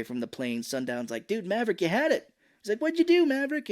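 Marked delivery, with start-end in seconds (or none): 1.3-2.3 high-pitched retold complaint; acted exasperation; exasperated co-pilot. 2.8-3.7 much higher, louder demand; acted indignant outburst; exasperated co-pilot. Retold co-pilot confronting pilot.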